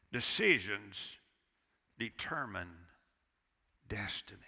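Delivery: low, soft, slow "decisions determine destiny"; preacher drives home the key line; slow emphatic. Real, not acted. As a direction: space each word out weightily. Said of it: "Don't forget the truth".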